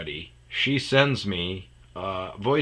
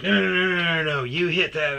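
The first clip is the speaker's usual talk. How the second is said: mock competitive protest; 7 semitones higher, 4 dB louder than usual. Mocking her competitive protests.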